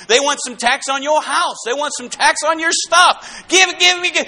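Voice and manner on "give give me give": mock greedy shout. Mocking greedy politicians.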